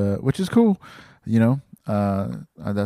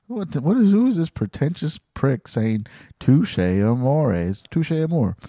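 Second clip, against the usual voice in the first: mocking listener; 6 semitones higher than usual. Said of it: "they're like".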